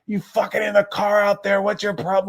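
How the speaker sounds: high, loud mock yell as grandpa. Grandpa yelling at Denise coming home late, high mock yell. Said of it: "it was like".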